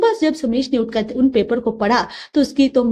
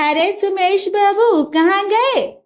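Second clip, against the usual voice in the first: higher, slow mocking taunt; neighbour taunting; 7 semitones higher, slower than usual. Neighbours jeer at Sumesh in the lane, mocking. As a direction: sneer at his fall.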